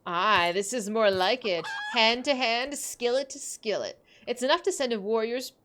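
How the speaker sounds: lightly voiced Scottish battle cry; a hint of a brash Scots fighter; Scottish warrior. Player voices Scottish skillet warrior.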